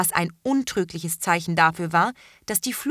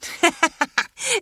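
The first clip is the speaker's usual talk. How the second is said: high, bright burst of giggling; a young witch giggling out loud; girl's laughter; 10 semitones higher, 3 dB louder than usual. A narrator's reading. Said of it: "Terra musste lachen".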